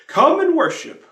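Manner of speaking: high, loud call of "Come and worship"; "Come and worship" cried out high and loud; ringing refrain. Acted. Hymn refrain.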